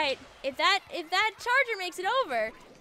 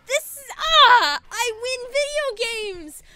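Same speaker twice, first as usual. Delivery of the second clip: much higher, slow triumphant yell; ecstatic shout; 8 semitones higher, 7 dB louder, much slower than usual. Real, not acted. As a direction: scream with joy. Victory celebration, shouting.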